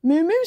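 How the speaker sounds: high, loud imitated mooing; two mock moos; cow's moo. A narrator's reading. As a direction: moo like a storybook cow. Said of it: "said cow".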